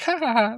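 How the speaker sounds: high mock "ha ha ha"; imitated villain laugh. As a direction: fake laugh, high-pitched. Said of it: "he gets up and he's like".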